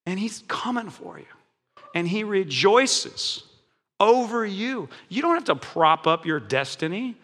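ordinary talk

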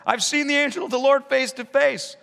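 high, loud terrified cry; exclaimed shout. Acted. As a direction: cry out in awe and fear. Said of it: "he exclaimed".

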